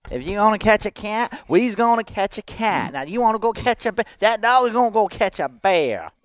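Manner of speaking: exaggerated high hillbilly voice-over; Crazy Bobby. Shown as a demonstration. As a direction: perform a cartoonish country oddball for the recording. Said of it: "I'll try that one more time".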